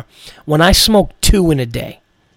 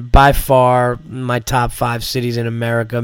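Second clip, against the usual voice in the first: normal talking voice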